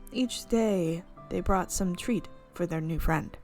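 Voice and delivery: ordinary talk, nothing marked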